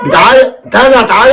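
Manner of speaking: fed up with the crying again; exasperated. Acted. (أب زهقان بصوت أعلى)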